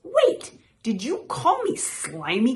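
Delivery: high, loud disbelief; offended croak. A narrator's reading. Frog stung by Toad's insult, high and offended. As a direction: start high on a startled protest, then question. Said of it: "croaked Frog".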